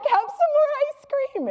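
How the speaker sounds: put-on high, loud coaxing voice to a crying child; coaxing mother. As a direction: sweet-talk a crying toddler. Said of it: "and I'm like".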